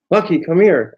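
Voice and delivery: mildly hammed-up yell to the dog; retold loud call. Imitating partner calling the dog as they walk by, mock loud call. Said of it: "screams it kinda loud".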